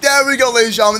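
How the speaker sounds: usual speaking voice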